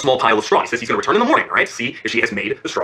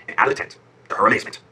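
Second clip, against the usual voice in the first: ordinary delivery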